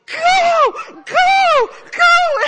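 high, loud drawn-out shouts; long, hollered bursts; coach's yell. Retold or imitated. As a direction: yell it from poolside like a coach urging a swimmer on. Coach yelling at swimmer mid-race.

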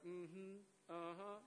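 hushed "mm-hmm" under the breath; barely audible mimicking hums; mock murmuring. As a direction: imitate very soft, intimate murmurs. Imitating couples grinding at party.

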